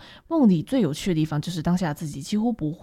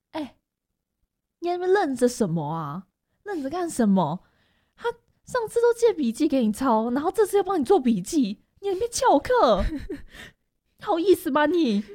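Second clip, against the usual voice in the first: scolding classmate; 8 semitones higher than usual. Retold or imitated. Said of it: "中间女同学跟我说".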